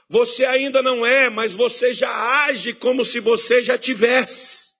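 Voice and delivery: loud, high fervent preaching; full-force preaching. Acted. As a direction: drive the congregation with conviction.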